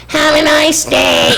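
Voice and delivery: ticket lady's voice. Retold or imitated. Imitating the ticket lady's dismissive goodbye, slow syrupy voice. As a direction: drag it out like a fake-polite clerk. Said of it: "that was her voice".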